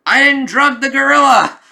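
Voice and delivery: high, loud indignant yell; outraged shout. Acted. Hero yelling at the police that he never drugged the gorilla, high outraged shout. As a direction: shout the denial at full force. Accused hero protests his innocence.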